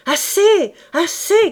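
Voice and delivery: high, loud cry to stop; shouted protest. A narrator's reading. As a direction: yell it out as an alarmed onlooker. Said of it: "cria-t-on". (cri fort pour arrêter)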